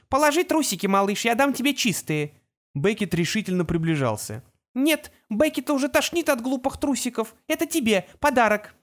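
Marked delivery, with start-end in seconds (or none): 0.1-2.3 calling out. A narrator's reading. Calling to boy from tower. 4.7-8.8 put-on high, eager child voice; a small boy bursting with glee; excited little boy. A narrator's reading. Excited boy offers gift.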